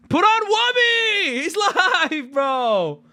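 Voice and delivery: high, loud, slowed mock fan yell; excited fan voice. Two viewers in Iraq telling each other he's live, mock hype. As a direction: ham it up as a hyped viewer. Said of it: "they're just like".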